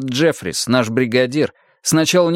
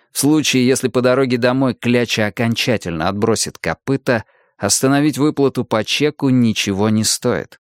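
ordinary delivery, nothing marked

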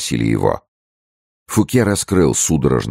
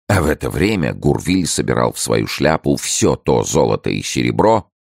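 usual speaking voice

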